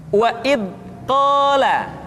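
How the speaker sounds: "wa id qala" with sound stopped dead at "id"; held-back dza, mistaken. Shown as a demonstration. Teacher showing the first mistake, blocked sound. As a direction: cut off "id", then pause before "qala". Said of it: "suara yang seringkali tertahan".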